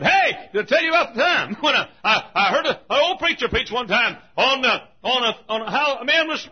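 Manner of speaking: high, loud revival-preacher shout; full-force preaching. Acted. Old doctor retelling his boyhood sermon story, shouted high.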